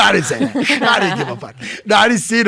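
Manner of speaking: normal talking voice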